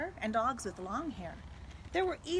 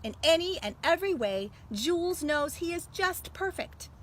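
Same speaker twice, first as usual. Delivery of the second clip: louder, animated read-aloud; story reading; 4 dB louder than usual. Acted. Librarian reading the story's ending to students. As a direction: tell it with full storyteller energy. Reads picture book aloud.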